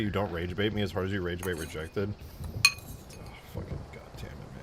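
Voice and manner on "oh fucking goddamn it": whispered, much softer frustration; muttered curse. Real, not acted. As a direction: curse under your breath. Frustration at game.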